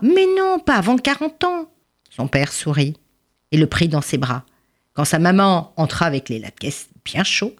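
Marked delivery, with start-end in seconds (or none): 0.0-1.7 very high-pitched protest; exclaimed refusal. Acted. Father refuses impatient son. (refus outré, voix très aiguë)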